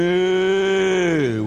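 a smug calf's moo, drawn out; mock bull calf moo; smirking calf moo. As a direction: moo like a calf that got the last laugh. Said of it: "and he goes".

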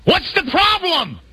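high, drawn-out exasperated yell; comic full-voiced shout from across the boat; yelling back. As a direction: holler it over roaring wind. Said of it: "he has to yell back".

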